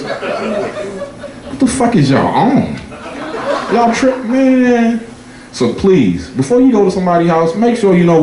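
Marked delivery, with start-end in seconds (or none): 0.0-5.0 high, slow incredulous aside; can't believe these people; exasperated disbelief. Real, not acted. Comedian to the audience about the couple, high incredulous.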